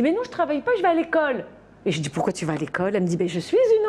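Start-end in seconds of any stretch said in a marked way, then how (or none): 0.0-1.4 put-on higher, lightly childish reply; a hint of the little girl; child's voice. Nanny lightly voicing the girl explaining she goes to school. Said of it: "et elle me dit".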